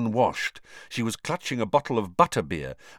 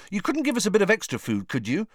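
Ron voice; 11 semitones higher, faster than usual. A narrator's reading. Ron asks elves for food.

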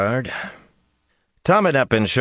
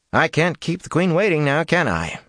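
cheery; 11 semitones higher than usual. A narrator's reading. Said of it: "he said cheerily".

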